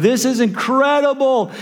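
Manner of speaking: high, awestruck cry of delight; like the greatest concert ever; thrilled. Retold or imitated. Disciples on the mountain, awestruck and thrilled. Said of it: "They're loving life right now".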